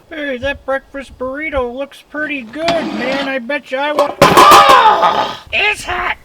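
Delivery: high voice, yelping it's hot; silly high character voice. Pretend. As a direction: play a greedy buddy who burns his mouth. Another character wants burrito, burns.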